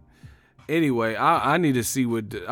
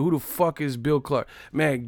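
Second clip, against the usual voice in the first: ordinary delivery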